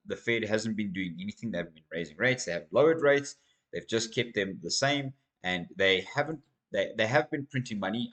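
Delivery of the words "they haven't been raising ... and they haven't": ordinary delivery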